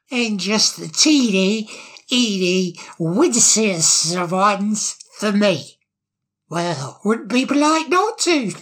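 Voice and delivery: put-on slurring, giggly drunk voice; tipsy bird, high and mocking; town drunk. Patoo asks for a drink.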